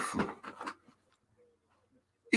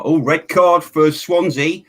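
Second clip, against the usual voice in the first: excited; 6 semitones higher, 7 dB louder, slower than usual. Real, not acted. Reacting to live match.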